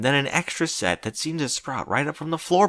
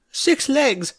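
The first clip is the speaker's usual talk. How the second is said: ordinary delivery, nothing marked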